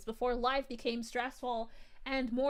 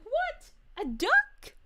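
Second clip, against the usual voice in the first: high, loud incredulous question; comic squawk of disbelief; mock bafflement; 11 semitones higher, 9 dB louder, much slower than usual. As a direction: play up total confusion. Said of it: "I'm like".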